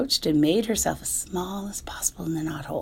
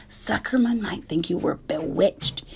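ordinary delivery

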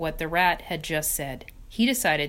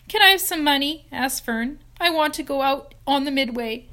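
high, louder pleading; eager child; 8 semitones higher, 7 dB louder than usual. Acted. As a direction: beg eagerly. Fern begging for money.

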